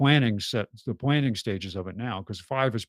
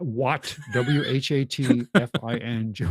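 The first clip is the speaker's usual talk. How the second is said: normal talking voice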